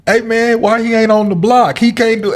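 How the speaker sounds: mock complaint. Partner challenges the player, imitated.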